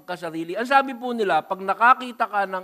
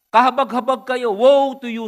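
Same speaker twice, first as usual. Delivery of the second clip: loud rebuke; 7 semitones higher, 12 dB louder than usual. Acted. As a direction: proclaim the woe high and loud. Pastor reading Jesus's rebuke aloud.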